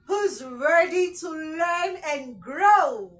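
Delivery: loud, slow, high hype-up question; rally the class with a big drawn-out call; rousing call. Real, not acted. Teacher rallying learners to grow, rousing call. Host rallies viewers at opening.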